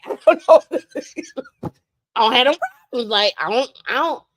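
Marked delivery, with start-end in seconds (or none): 0.0-1.7 laughing through the words. Real, not acted. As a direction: crack up mid-line. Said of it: "laughs heard".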